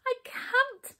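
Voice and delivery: very high helpless wail. A narrator's reading. Hop answering the angry king, high wail. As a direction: wail it tearfully. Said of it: "wailed Hop".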